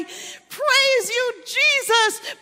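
high, loud cry of praise; exuberant praise. Acted. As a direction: belt it out to God in grateful worship. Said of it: "praise God and say".

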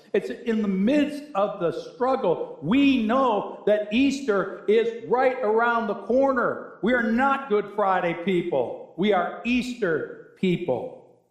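ringing, deliberate conviction; emphatic preaching. Real, not acted. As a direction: drive it home from the pulpit. Preaches with force.

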